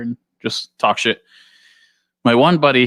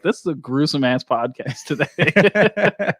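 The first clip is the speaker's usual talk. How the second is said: higher, drawn out into laughter; amused mock comment; 4 semitones higher, slower than usual. Voicing the podcast's viewers to a friend, slow and amused. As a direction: let laughter creep in as it slows.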